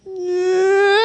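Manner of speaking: put-on high-pitched rising baby cry; imitated baby wail. Preacher showing babies' sudden outbursts, high imitated wail. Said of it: "they're crying their eyes out".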